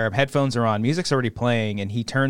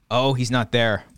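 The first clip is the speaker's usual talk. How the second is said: normal talking voice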